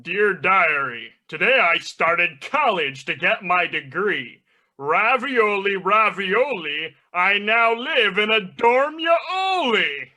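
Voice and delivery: cartoon villain voice, slow and drawn out; Plankton. Villain tells his diary about college, drawn-out cartoon chant. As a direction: stretch the words, climbing to a high finish. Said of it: "we are reading out the diary of Plankton".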